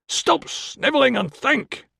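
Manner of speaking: high, slow bellowing father; father shouting. A narrator's reading. Father yelling at his snivelling son, high and slow. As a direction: roar it down at the cowering boy. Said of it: "As he shouted".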